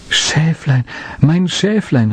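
high, glad cry of relief; joyful call. Acted. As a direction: call out happily on finding it. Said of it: "rief er freudig".

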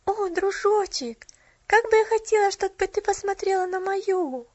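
put-on high, longing child voice; wistful sigh. A narrator's reading. Child wishing her friend could see the tree, high and wistful. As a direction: wish it aloud, drawing out the opening. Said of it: "вздохнула девочка".

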